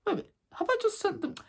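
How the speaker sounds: teacher dawning he sent them all out; teacher realising. Retold or imitated. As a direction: mutter the sudden realisation under the breath. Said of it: "Then he's like".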